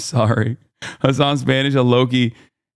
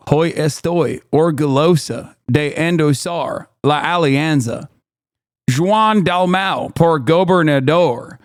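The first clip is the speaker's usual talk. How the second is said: deliberately bad American-accented Spanish, slow and drawn out; Peggy Hill; slower than usual. Streamer reads a Spanish endorsement aloud, deliberately bad American accent. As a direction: read Spanish slowly with heavy anglicized vowels. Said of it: "When I do the deliberate Peggy Hill".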